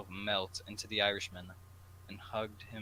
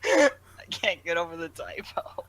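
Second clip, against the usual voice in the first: laughing aside; 9 semitones higher, 12 dB louder than usual. Real, not acted.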